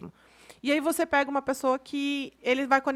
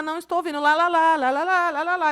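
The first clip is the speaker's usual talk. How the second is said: put-on higher, sing-song childish tune-out; filhos ignorando os pais; 4 semitones higher, 3 dB louder than usual. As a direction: chant it like kids blocking out their parents. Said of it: "vão virar a chavinha e falar". (criança fingindo que não ouve)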